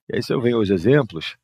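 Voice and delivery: ordinary talk, nothing marked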